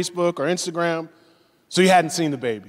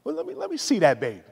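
put-on quick, higher, eager plea to see the baby; wife's hurried, pitched-up request; imitating eager wife; 7 semitones higher, 4 dB quieter, faster than usual. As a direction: rush it like a new mother dying to look. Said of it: "the first thing my wife said".

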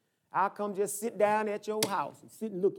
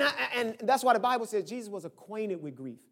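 normal talking voice

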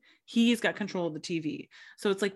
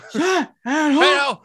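higher, louder, slow stretched gangster bellow; Pacino Scarface delivery; 8 semitones higher, 10 dB louder, much slower than usual. Retold or imitated. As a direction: roar it like Pacino on screen. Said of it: "That's how he does it".

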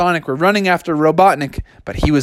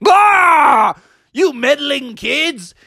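villain's shout; 10 semitones higher, much slower than usual. Acted. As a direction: bellow at the meddling kids. Villain catches the heroes.

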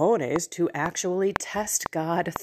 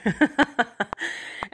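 higher burst of laughter; amused laugh; 5 semitones higher than usual. Real, not acted. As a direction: crack up at your own quote. Amused by the angel's greeting.

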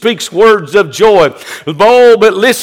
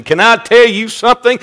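usual speaking voice

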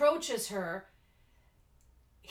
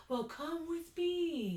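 put-on slow, soft, higher creepy old man; eerie, softly beckoning preacher; Reverend Kane; 5 semitones higher, 5 dB quieter, slower than usual. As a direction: lure a child away, drawing the words out. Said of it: "he's so creepy".

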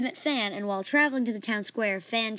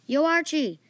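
higher-pitched call to a friend; mild yell; 6 semitones higher, 4 dB louder, slower than usual. Acted. Boy calls over to his friend Archie, mild yell. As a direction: call out, only a little louder. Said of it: "I yelled at Archie".

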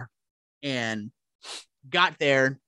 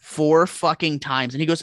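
ordinary talk

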